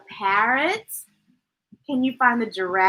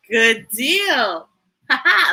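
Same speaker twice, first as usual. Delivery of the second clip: usual speaking voice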